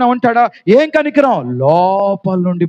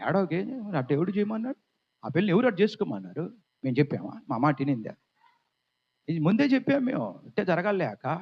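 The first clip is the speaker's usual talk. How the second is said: mock gossiping neighbours; 12 dB quieter than usual. Neighbours gossiping behind her back.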